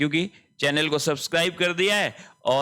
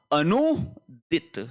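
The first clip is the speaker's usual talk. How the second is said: slow emphatic; much slower than usual. Shown as a demonstration. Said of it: "ध्यान रखें".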